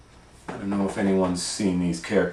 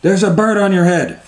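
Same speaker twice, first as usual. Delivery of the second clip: high, quick exclamation; Piggy calling out; 10 semitones higher, 10 dB louder than usual. A narrator's reading. Piggy telling Elephant what sits on him, high quick exclamation. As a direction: pipe it up high and brisk. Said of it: "says Piggy".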